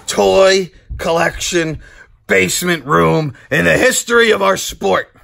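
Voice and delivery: emphatic showman's speech, punched phrases; wrestling promo. Pretend. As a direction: proclaim it to the fans like a champion. Wrestling-style promo to viewers.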